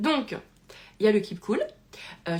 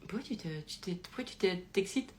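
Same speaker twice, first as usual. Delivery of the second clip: quiet, puzzled calm, barely raised; hyper zen; 2 semitones lower, 9 dB quieter than usual. Retold or imitated. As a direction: imitate someone utterly unruffled. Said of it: "Et lui, hyper zen".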